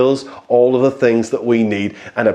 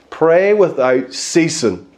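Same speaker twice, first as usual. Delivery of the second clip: slow, weighty quote of Paul; solemn scripture quote; 3 semitones higher, much slower than usual. Retold or imitated. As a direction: lay each word down slowly. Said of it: "said it like this".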